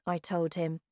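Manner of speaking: ordinary delivery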